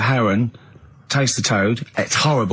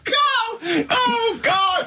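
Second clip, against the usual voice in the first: high exclamations through helpless laughter; weak with laughter, high-pitched; laughing in disbelief; much slower than usual. Real, not acted. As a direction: cry out the name while cracking up. Exasperated, laughing at Carl's pun.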